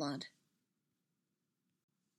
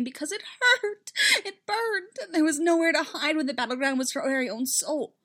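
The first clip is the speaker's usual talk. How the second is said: rising, higher, anguished narration; pained outcry; 7 semitones higher, 4 dB louder than usual. A narrator's reading. Telling of Padme's inner battle, pained and rising. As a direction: let the pain push the voice up high. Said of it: "couldn't contain her scream".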